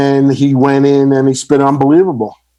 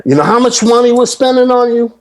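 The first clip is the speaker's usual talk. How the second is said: higher, mock-indignant label boss; 11 semitones higher than usual. Puffy scolding the rapper over money, mock outraged higher voice. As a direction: imitate an outraged boss dressing someone down.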